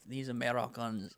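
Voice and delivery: brief put-on French accent. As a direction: say it like a Frenchman, half-committed. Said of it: "I'm gonna stop this French accent".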